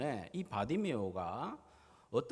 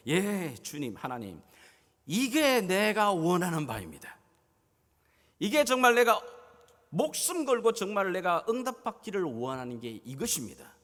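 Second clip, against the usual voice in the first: earnest plea; 3 semitones higher, 6 dB louder than usual. Pretend. Believer answering God's question with a loud, earnest plea. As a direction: beg God with everything you have.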